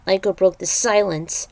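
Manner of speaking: ordinary delivery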